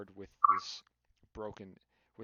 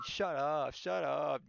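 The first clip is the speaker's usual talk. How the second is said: higher, louder, drawn-out mimicry; mocking imitation; 6 semitones higher, 5 dB louder, slower than usual. Everyone in voice chat mocking one friend, higher taunting mimicry. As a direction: chant it tauntingly, stretching each SHUT UP. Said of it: "just mocking Robert".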